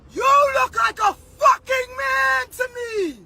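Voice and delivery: high, slow, tearful yell; crying rant. Real, not acted. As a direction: shout through the tears.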